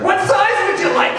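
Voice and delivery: over-the-top cheery service voice; mock waiter voice. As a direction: squeak the question like a tired barista. Coffee waiter quoted.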